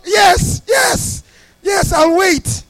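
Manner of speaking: very high, loud cries of agreement; eager retold shout. Stranded man accepting the pastor's offer, imitated eager shouting.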